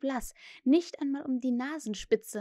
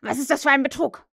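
loud, angry demand; thundering accusation; 3 semitones higher, 8 dB louder, faster than usual. A narrator's reading. Hook suspects his men of trickery, angry shout. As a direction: demand an answer furiously. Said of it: "donnerte Hook".

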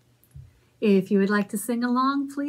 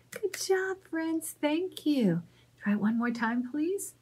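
soft, high and encouraging; quiet teacher praise; gentle praise; 5 semitones higher, 6 dB quieter than usual. Real, not acted. Praising children softly.

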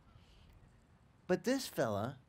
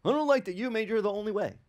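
mock complaint; 7 semitones higher, 4 dB louder than usual. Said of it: "go yell at him".

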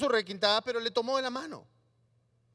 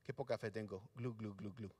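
mock sinking Pedro; 12 semitones lower, 15 dB quieter than usual.

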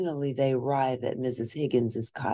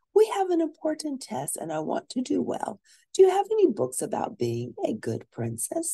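lightly voiced girl, higher and eager; young princess voice; 13 semitones higher than usual. Sophia asking the librarian for books, eager girl voice. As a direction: ask like a keen young girl.

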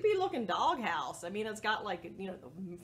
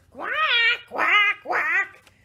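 loud, raised squawks; duck quacking; 6 semitones higher, 9 dB louder, slower than usual. Acted. Duck being volunteered by mouse, loud protesting quacks.

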